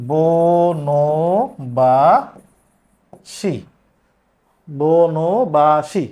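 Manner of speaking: বনবাসী drawn out very slowly, বো-নো-বা-সি; pronunciation demo. Shown as a demonstration. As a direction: stretch বনবাসী syllable by syllable. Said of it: "উচ্চারণ কি হবে?". (ধীরে টেনে বনবাসী উচ্চারণ)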